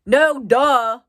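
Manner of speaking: sarcastic. Retold or imitated. Answering the paramedic, a loud sarcastic retort. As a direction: throw it back at him, obviously.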